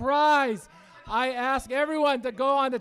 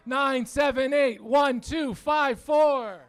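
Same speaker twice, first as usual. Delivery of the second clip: slow calling out; slower than usual. Real, not acted. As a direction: call the number slowly across the room. Repeating number slowly for crowd.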